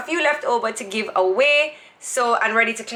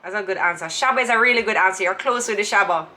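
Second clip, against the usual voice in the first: usual speaking voice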